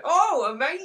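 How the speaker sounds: high, loud mock gush. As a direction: echo the compliment with delight. Gushing echo of compliment.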